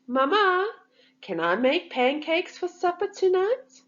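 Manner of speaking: high, hopeful asking voice; eager request. A narrator's reading. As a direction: coax Mama sweetly. Child asking mother.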